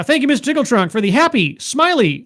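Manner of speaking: fast, high, gushing thanks; excited thanks. Real, not acted. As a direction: shout the thanks with delight. Hyped thanks for a viewer gift.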